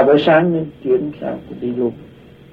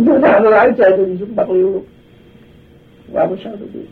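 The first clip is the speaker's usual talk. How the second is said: ordinary delivery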